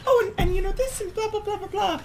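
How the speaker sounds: very high, fast chirpy mom voice; high mom impression. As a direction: squeak it out like a breezy mom. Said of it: "she'll be like".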